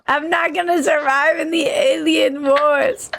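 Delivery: high, odd alien voice. Pretend. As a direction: squeak it out like an alien. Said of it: "You're getting better".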